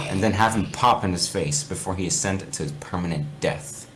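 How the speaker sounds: a lightly dramatic reading of a spooky fanfic; story narration. Acted. Reading fanfic aloud to gaming friends, storyteller voice. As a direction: read it like a campfire tale, lightly.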